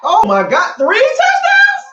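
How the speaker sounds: high, slow drawn-out cheer; excited shout. Real, not acted. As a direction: yell it out in disbelief at the play. Reacting to a touchdown.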